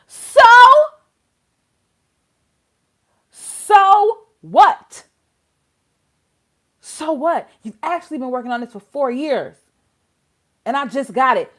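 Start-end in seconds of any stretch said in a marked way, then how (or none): 0.1-7.5 loud, high "so what"; exasperated outcry. Real, not acted. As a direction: throw it back at them, loud and incredulous. Indignant outburst.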